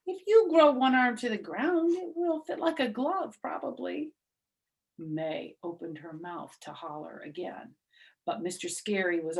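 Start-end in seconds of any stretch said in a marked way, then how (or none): 0.1-4.1 high, bratty taunting tone, much higher; snooty mock voice. May taunts Junie about the sleeve, high and snide. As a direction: sneer it like a smug classmate.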